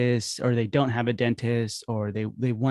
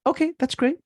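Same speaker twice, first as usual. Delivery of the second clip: cheerful phone voice. Shown as a demonstration. Cheerful receptionist phone script.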